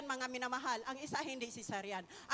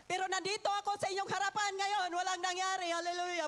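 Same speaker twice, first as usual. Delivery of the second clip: triumphant proclamation; 4 semitones higher than usual. Real, not acted. Triumphant testimony climax.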